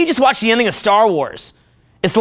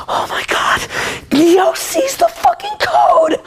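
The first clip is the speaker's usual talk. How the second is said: hushed start, then much higher slow crying squeal and laugh; whispered then shrieked awe; 5 dB louder, slower than usual. Acted. Fan reliving Neo seeing the code, awe bursting into a shriek. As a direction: build from a breath to a screech of joy.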